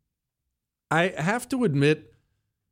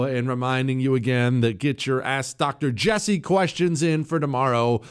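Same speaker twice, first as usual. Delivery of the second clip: usual speaking voice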